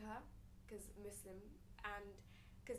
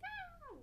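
mock possessed cry. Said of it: "all you can hear is her laughing".

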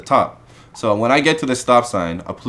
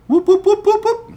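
much higher sing-song police siren whoops; siren imitation. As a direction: mimic a cop siren with your voice.

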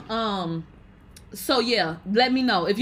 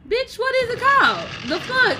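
indignant disbelief; 11 semitones higher, 3 dB louder than usual. Real, not acted. Indignant about mocked speech.

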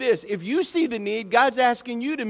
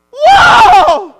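huge high laughing whoa; overjoyed shout of sight regained; laughing amazed shout; 22 dB louder than usual. Acted. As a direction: shout with joyful disbelief. Mimics healed blind man's amazement.

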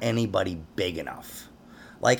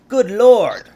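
higher, much louder "Good Lord" with a falling tune; hammed-up exclamation; 6 semitones higher, 10 dB louder than usual. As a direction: bellow "Good Lord" like a shocked old man. Punchline of the mock quote.

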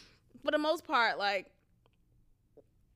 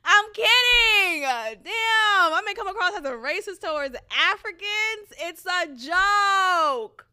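high, loud, slow laughing plea; laughing protest; 8 semitones higher, 8 dB louder, slower than usual. Real, not acted. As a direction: laugh while taking it back. Laughing walk-back after joke.

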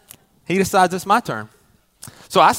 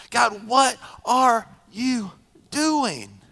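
pleading; 5 semitones higher, much slower than usual. Retold or imitated. Closing the list of prayers.

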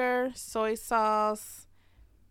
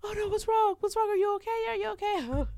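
worried friend; 12 semitones higher than usual. Retold or imitated. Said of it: "And Leah was like".

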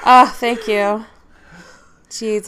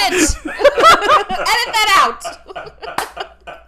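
laughing shout; 9 semitones higher, 5 dB louder, much slower than usual. Real, not acted. Laughing plea to cut recording.

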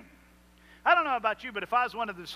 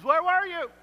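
pleading cry; 11 semitones higher, 5 dB louder than usual. Acted. Preacher repeats the believer's cry.

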